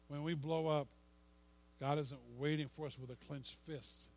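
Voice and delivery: ordinary talk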